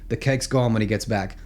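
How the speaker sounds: usual speaking voice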